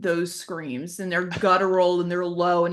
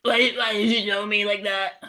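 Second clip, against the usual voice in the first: teddy bear voice. Acted.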